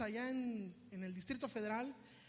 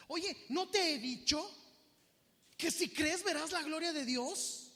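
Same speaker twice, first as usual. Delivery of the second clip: high, slow, emphatic challenge; raised, deliberate rebuke; stern reproving question; 5 semitones higher, 4 dB louder, slower than usual. Acted. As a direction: confront them with what they were already told. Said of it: "Hace esta expresión".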